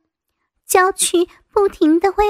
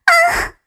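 pained scream; 12 semitones higher than usual. Acted. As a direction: scream out as if struck. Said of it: "林娜一声惨叫". (凄厉惨叫)

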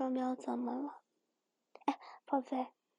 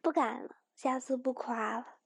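usual speaking voice

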